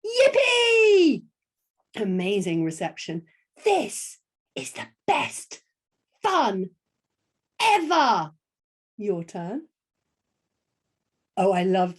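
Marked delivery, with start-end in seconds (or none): 0.0-1.2 high, loud joyful cheer; whooping, over-the-top excitement; so excited. Shown as a demonstration. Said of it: "say it like we're so excited". 3.6-8.3 slow, high, emphatic glee; drawn-out delighted exclamation; excited. Shown as a demonstration. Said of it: "say it like we're so excited".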